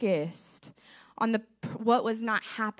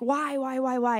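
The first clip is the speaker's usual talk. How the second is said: retold insistent questioning, pitched up; pleading; 4 semitones higher than usual. Woman asking God for an answer, pleading in retelling. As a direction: press the question, as if recalling it.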